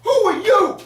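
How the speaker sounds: very high, loud shriek; shrieking Lakeisha. Retold or imitated. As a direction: screech it at the wife in outrage.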